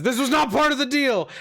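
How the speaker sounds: high, mock-dismayed complaint; indignant protest. Game master voices a foe about to be knocked out, indignant. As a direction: protest as the deal goes wrong.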